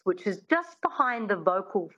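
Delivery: usual speaking voice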